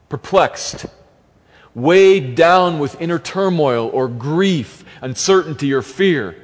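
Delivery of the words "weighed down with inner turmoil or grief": "weighed down" drawn out slowly and pressed hard; stress on weighed down. Shown as a demonstration. Preacher stressing "weighed down" to the congregation, slow and heavy. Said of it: "the emphasis there is on weighed down".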